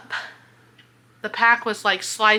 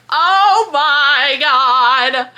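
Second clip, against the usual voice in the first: stunned; 4 semitones higher, 6 dB louder, much slower than usual. Real, not acted. Shocked recalling library scene.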